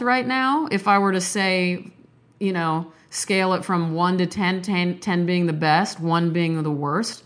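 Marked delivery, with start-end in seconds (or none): none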